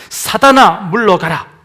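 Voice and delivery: loud stern command to Satan; commanding shout. Acted. Preacher voicing Jesus rebuking Satan, loud command. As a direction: cast him out with full force.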